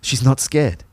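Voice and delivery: usual speaking voice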